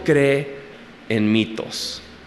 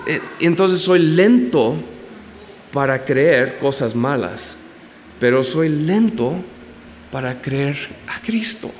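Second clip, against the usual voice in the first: usual speaking voice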